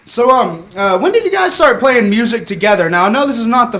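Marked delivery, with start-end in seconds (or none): none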